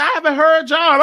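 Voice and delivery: talking back. Retold or imitated. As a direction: call out to the voices. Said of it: "I said".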